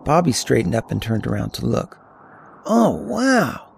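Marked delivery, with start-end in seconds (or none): 2.7-3.7 put-on high-pitched teenage amazement; awed, under breath. A narrator's reading. Teen seeing snow-capped peaks, high awed wonder. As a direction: let the wonder swoop up high. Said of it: "nearly under his breath".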